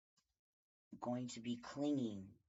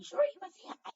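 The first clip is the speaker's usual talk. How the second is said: squealy pet voice urging the dog to greet; pet talk; 9 dB louder, much faster than usual. Real, not acted. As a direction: sing it up high to coax the puppy. Talking to her dog.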